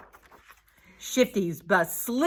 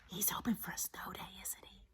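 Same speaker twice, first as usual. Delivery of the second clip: soft, breathy aside; hushed whisper; 18 dB quieter than usual. A narrator's reading. Narrator whispers about sleeping Shifty, hushed. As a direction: whisper it so you don't wake him.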